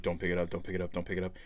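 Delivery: soft, low urgent repetition; tense whisper-like plea. Retold or imitated. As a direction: plead under your breath. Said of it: "the whole time he's like".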